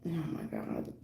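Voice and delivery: breathy whispered aside; startled whispered oh my God. Real, not acted. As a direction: drop to a whisper under the breath. Reacting to chat, hushed aside.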